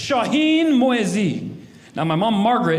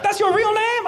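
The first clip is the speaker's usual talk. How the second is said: much higher, amazed voice; voice goes even higher. Retold or imitated. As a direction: shoot up high in disbelief. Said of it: "His voice went up even higher".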